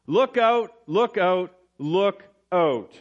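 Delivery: each look out called out, the first loudest and highest; ringing repeated warning; urgent triple warning. Shown as a demonstration. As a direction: sound the alarm three times over. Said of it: "it's used for emphasis".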